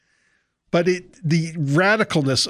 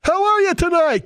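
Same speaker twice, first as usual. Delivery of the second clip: much higher and louder greeting; hyped emcee; 13 semitones higher, 3 dB louder than usual. Retold or imitated. Preacher mimics a rally emcee greeting the crowd, high and loud. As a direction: pump the crowd up like an MC. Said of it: "It's just part of the schlick".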